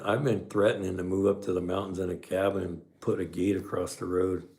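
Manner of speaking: ordinary delivery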